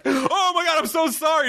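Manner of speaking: high, frantic apologizing; mock panicked apology. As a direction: imitate someone caught and horrified. Caught kicker apologizes in panic.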